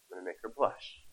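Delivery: soft aside. Real, not acted. Aside about his wife present.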